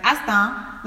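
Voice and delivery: Astan said slowly in a West African accent, open "a" and rising. Shown as a demonstration. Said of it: "you need to say it with the African accent".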